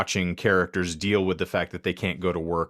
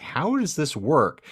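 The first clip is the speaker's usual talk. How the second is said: puzzled wonder; 9 semitones higher, 3 dB louder, slower than usual. Retold or imitated. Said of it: "And you're like".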